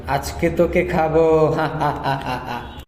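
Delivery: a hint of a witch, then an evil cackle; lightly voiced witch cackling. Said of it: "আর ডাইনিটি বলতে লাগলো". (ডাইনির হাসি)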